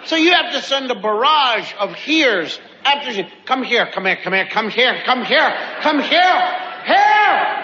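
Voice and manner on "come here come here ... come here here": auctioneer. Retold or imitated. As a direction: rattle it off like an auctioneer, then bark it. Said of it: "Sound like a tobacco auctioneer".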